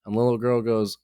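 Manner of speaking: normal talking voice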